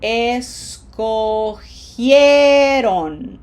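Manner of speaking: escogieron very slow, syllable by syllable, loud stretched gie; emphatic teacher demo of escogieron; whole-word demo. Shown as a demonstration. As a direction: draw out escogieron, with an h sound and both vowels in gie. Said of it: "So the whole word is".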